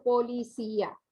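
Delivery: "Policía" broken into Po-li-cí-a; syllable by syllable. Shown as a demonstration. Teacher repeating the example for pupils, "Policía" split as Po-li-cí-a. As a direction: sound out "Policía" as four syllables, Po, li, cí, a. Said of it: "se separan en sílabas diferentes".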